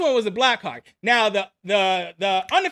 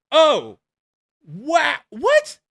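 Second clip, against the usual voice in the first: shocked what; 3 semitones higher, 4 dB louder, much slower than usual. Retold or imitated. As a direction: pitch shoots up on a stunned what. Shocked at the officer running off.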